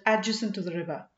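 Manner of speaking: plain reading voice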